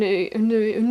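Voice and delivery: usual speaking voice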